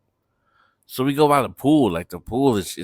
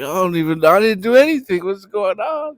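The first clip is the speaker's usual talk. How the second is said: confused protest; 9 semitones higher, 6 dB louder than usual. Retold or imitated. Confused Breeze defends himself.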